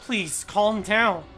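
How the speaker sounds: loud, slow, begging call to calm; pleading shout. Acted. Junpei holding June, pleading loudly for calm. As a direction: plead with her firmly.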